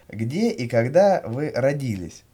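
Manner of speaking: ordinary talk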